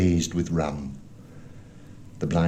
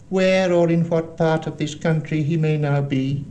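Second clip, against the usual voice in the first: high, raised beggar's chant, held almost on one note; odd sing-song; 9 semitones higher, 3 dB louder than usual. A narrator's reading. Blind man addresses the air before him, odd raised sing-song. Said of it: "raising his voice in an odd sing-song".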